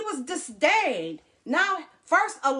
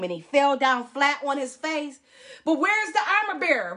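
normal talking voice

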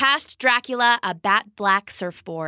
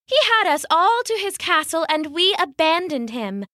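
a touch of motherly scolding, pitched up; mummy lecturing the lazy friends; earnest mummy; 6 semitones higher than usual. Acted. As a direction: appeal to their conscience.

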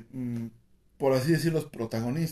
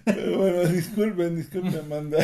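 still giggling; 4 semitones higher than usual. Real, not acted. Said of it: "el ataque de risa".

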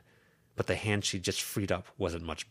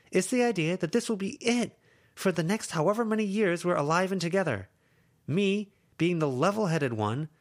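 high and louder; emotional confession; 11 semitones higher, 4 dB louder than usual. Acted. Lucy to Verona, emotional confession. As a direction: let the fear drive it.

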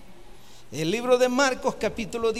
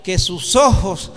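rousing call; 6 dB louder than usual. Acted.

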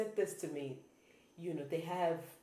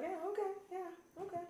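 placating agreement; 13 semitones higher, 8 dB quieter than usual. Retold or imitated. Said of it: "I would rather just be like".

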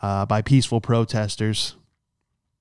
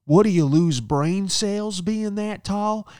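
high, slow, sneering put-on voice; mocking rude lady; 7 semitones higher, much slower than usual. Rude guest mocking his height, high sneering voice. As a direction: roast him like a snide stranger. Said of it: "and she goes".

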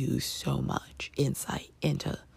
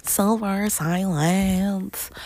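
drawn-out, higher and louder announcer flourish; playful announcement; 4 semitones higher, 5 dB louder, much slower than usual. Pretend. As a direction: announce it big and slow, stretching the words. Playful announcement of spoiler section.

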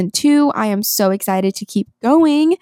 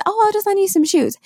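much higher, light imitation of the friend; friend's breezy offer; 11 semitones higher than usual. Friend offering her big shoes, lightly imitated high. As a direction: chirp it like an overeager friend.

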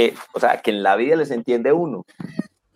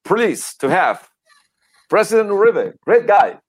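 Obama impression; 3 semitones higher than usual. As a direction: lightly do Obama's smooth English. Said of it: "Barack Obama decía".

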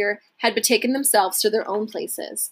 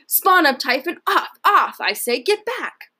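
raised, higher furious commands; overseer's shout; 6 semitones higher than usual. A narrator's reading. Overseer Porcus shouts the slaves back, raised and furious. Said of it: "Porcus shouted".

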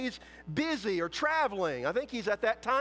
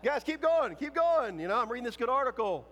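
mocking taunt; 5 semitones higher than usual. Elijah jabbing at the prophets from the sidelines, sarcastic. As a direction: cheer them on sarcastically, barely paying attention. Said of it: "taunting them".

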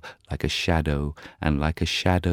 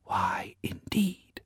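soft whispered daisy; whispering; 14 semitones higher, 7 dB quieter, much slower than usual. Acted. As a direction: whisper it to a neighbour. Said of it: "whispered a daisy ... soft, low voice".

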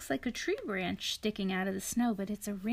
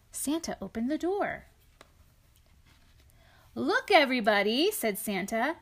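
storybook read-aloud, lightly lilting; reading to children; 2 semitones higher, 5 dB louder than usual. A narrator's reading. Parent reading a picture book to a child, gentle storytelling. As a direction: read it to a little one beside you.

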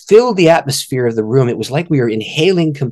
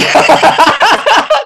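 big high laugh; 7 dB louder than usual. Real, not acted. Telling the host, a loud high laugh.

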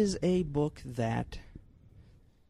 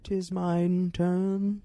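mock old English; 5 semitones higher, much slower than usual. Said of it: "mock archaic reply".

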